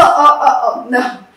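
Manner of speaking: ordinary talk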